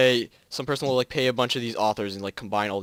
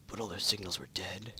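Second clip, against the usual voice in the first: whisper; 4 semitones lower, 13 dB quieter than usual. Acted.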